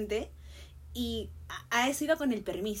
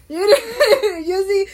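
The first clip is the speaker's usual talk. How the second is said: higher, much louder, laughing as she talks; 8 semitones higher, 14 dB louder, much slower than usual. Real, not acted. Student admitting she was the keen one, laughing. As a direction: talk through a burst of laughter.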